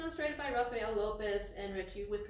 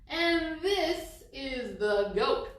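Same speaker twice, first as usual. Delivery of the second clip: announcing the goat; 2 semitones higher, 9 dB louder, much slower than usual. Acted. Reading animal book to kids.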